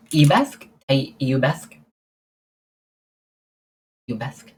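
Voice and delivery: Iubesk sounded out as a question; unsure pronunciation. Shown as a demonstration. Streamer who doesn't know how to pronounce Romanian, tentative. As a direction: try Iubesk in pieces, lifting the end. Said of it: "I don't know how to pronounce".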